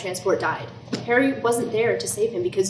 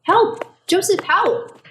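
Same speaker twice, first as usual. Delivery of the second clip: high, loud terrified cry; desperate shrieks for help; screaming for help; 10 semitones higher, 5 dB louder, much slower than usual. Acted. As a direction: scream for rescue in panic.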